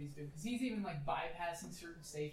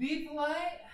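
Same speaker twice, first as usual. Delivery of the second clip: pilot voice; 9 semitones higher, 9 dB louder, slower than usual. Acted. Pilot advises politely.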